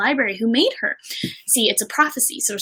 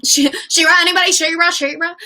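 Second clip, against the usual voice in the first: higher-pitched, repeated call to the audience; calling for response; 6 semitones higher, 4 dB louder, faster than usual. Real, not acted. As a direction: fish for an answer from the crowd. Calling on chat for recognition.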